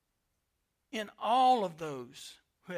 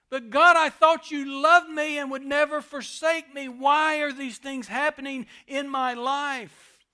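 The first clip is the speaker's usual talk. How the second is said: higher and much louder, a pained cry; anguished plea to God; 5 semitones higher, 10 dB louder than usual. Acted. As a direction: cry out to God in hurt disbelief. Believer crying out to God.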